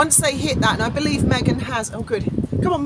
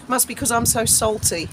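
ordinary delivery

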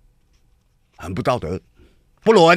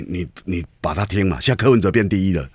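ordinary talk, nothing marked

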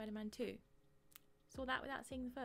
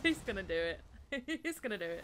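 laughing; 7 dB louder than usual. Real, not acted. Gleeful reaction, laughing.

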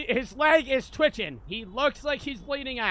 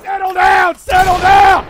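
loud high police shout; shouting cop; 8 semitones higher, 15 dB louder, slower than usual. Pretend. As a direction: yell at them to calm down. Shouting at suspects in raid.

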